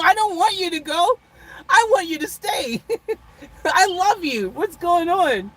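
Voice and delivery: mock needy diva. Vlogger begging viewers not to leave, mock needy. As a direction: play the hurt star begging them to stay. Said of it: "I'm just being funny".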